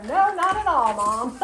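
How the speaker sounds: high, loud refusal; hammed-up, high-pitched protest to a parent; expressing a no. Child answering mom in a store, exaggerated loud refusal. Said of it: "you wanna express".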